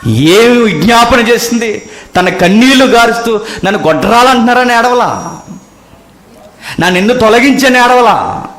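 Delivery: high, loud pleading sermon; fervent preaching over music; impassioned preaching. Acted. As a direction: cry it out from the pulpit. Preaching a weeping mother's plea.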